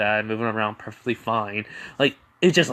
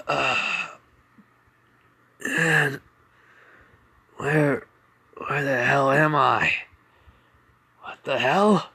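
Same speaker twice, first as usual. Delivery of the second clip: strained voice, raised and halting; groggy waking confusion; 3 semitones higher, 3 dB louder, slower than usual. Acted. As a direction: come to slowly, confused about where you are. Izuku wakes up confused in hospital.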